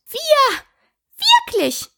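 very high, squeaky outrage; indignant mouse. A narrator's reading. Trembling mouse answering Alice, indignant. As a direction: squeak each word in offended disbelief. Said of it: "die bis zur Schwanzspitze zitterte".

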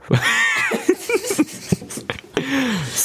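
high shrieking burst of laughter. Real, not acted. Loud laugh at a botched start.

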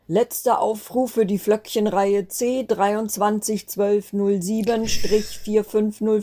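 announcer call. Acted. Grandfather calling the last boarding over the speaker, slow announcer voice.

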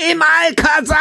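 high, roaring party yell; bellowed shout. Retold or imitated. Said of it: "brüllte er mich da an".

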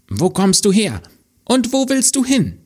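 put-on high, bright-eyed boy asking a stranger; young boy's voice. The boy asks the girl where she comes from, high child voice. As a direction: pitch up high like a curious young lad.

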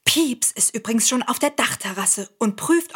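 ordinary talk

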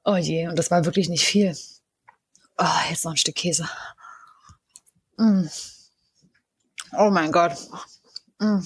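whispered, teary, mouth on fire; mouth burning from spicy food. Real, not acted. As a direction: push the words through the chili heat. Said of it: "mir laufen auf jeden Fall die Tränen". (mit brennendem Mund, halb geflüstert)

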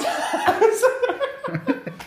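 squealing laugh; high burst of laughter. Real, not acted.

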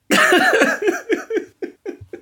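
bright burst of laughter; high laugh. Real, not acted. Laughing at own story.

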